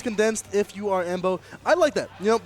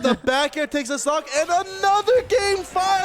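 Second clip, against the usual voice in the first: high, slow hype call; excited call; 8 semitones higher, slower than usual. Real, not acted. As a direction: stretch out the big finish. Game-deciding call.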